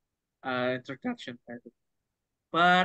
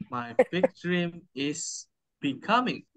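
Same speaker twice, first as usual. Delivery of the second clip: slow model phrase; 3 semitones higher, 3 dB louder, slower than usual. Shown as a demonstration.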